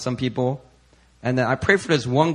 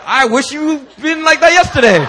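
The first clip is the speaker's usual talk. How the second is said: teasing quip; 12 semitones higher, 9 dB louder than usual. Retold or imitated. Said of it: "And I was like".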